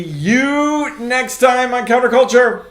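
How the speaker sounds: loud, high, drawn-out sign-off; big show farewell. Real, not acted. Co-host saying see you next time, loud and stretched out. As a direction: call out a slow, booming goodbye.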